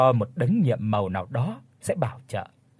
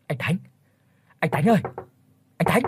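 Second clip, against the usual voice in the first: raised calls through a door; urgent calls at the door at night; calling out; 4 semitones higher, faster than usual. Acted. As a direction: shout for him to wake up. Banging door calling brother.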